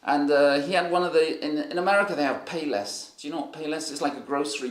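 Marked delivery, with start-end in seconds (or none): none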